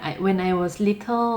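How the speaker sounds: English in a relaxed Thai accent, little rising at the end. Shown as a demonstration. Teacher shows learners an acceptable version, Thai accent. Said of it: "เราอาจจะสำเนียงไทยเป็น".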